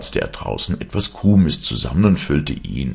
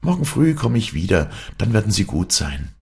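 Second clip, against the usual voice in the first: lightly voiced, higher-pitched hero; storybook character speech; 8 semitones higher, faster than usual. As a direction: pitch the promise up, animated. Hero promises, quoted in story.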